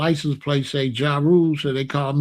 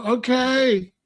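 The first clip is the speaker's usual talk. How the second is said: compliant reply; 7 semitones higher than usual. Retold or imitated. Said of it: "And they would go".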